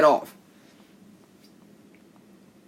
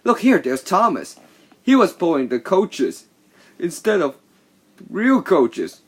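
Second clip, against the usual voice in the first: higher, louder mocking taunt; teasing; 5 semitones higher, 4 dB louder than usual. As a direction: jeer at the little engine. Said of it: "the other big engine was teasing him".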